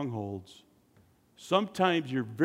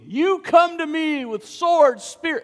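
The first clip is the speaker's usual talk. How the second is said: bold reply; 13 semitones higher, 8 dB louder, slower than usual. Acted. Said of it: "David replied to the Philistine".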